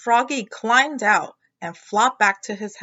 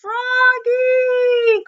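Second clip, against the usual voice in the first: much higher, louder sung-out call; 8 dB louder than usual. A narrator's reading. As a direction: call across the pond. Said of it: "called his mother".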